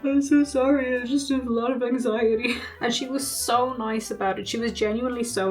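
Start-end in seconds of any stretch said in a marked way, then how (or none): 0.0-2.6 imitated crying. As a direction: blubber an apology, playing up your own tears. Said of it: "I could not stop crying".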